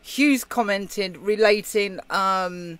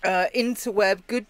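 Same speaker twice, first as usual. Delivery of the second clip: ordinary delivery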